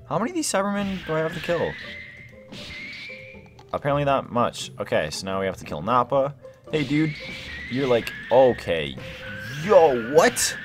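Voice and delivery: ordinary talk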